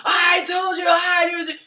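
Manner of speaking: triumphant loud I-told-you-so; prideful gloating. Acted. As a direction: shout it smugly. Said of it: "this is where pride comes in".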